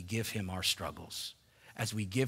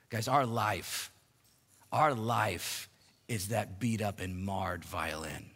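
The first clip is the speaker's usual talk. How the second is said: usual speaking voice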